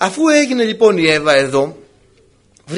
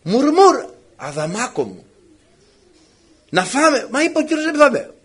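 coaxing, wheedling wife, pitched high at times; lightly voiced nagging wife pleading to eat; nagging Eve; 6 semitones higher than usual. Wife nagging Adam to eat the fruit, mild wheedling murmur. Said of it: "Τη μουρμούρα".

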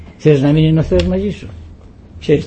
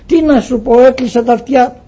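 high, louder complaining neighbour; indignant neighbour; 8 semitones higher, 6 dB louder than usual. Acted. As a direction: play the riled-up parishioner venting. (αγανακτισμένος γείτονας, πιο ψηλά και δυνατά)

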